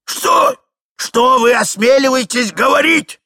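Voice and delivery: put-on high, loud old man's outburst; outraged shout. A narrator's reading. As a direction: explode at the insolence. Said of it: "закричал старик". (гневный крик старика)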